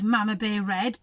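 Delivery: plain reading voice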